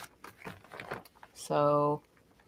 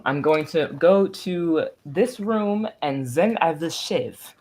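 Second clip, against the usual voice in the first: put-on slow, drawn-out comic Russian accent; 4 semitones higher, slower than usual. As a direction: imitate mom's hammed-up Russian board-game character. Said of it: "this hilarious, like, Russian".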